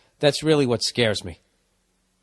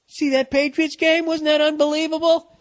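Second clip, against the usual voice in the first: much higher, starry-eyed fan voice; mock gushing fan. Mocking fans chasing the high, higher gushing voice. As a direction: gush like a fan who's seen it all.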